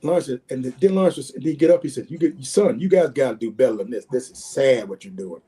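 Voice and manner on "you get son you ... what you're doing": low, slowing reproach; retold stern scolding. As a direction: imitate a disappointed veteran lecturing linemen. Retelling Lawrence scolding his linemen.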